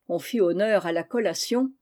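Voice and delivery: ordinary delivery, nothing marked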